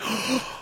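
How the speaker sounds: quiet, long gasp of amazement; imitated stoned gasp; slow awed gasp. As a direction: suck in air slowly, stunned. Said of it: "the most slowest of manner".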